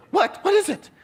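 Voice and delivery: high, snappy falsetto imitation of the wife; irritated wife. Wife behind locked door to husband, irritated falsetto.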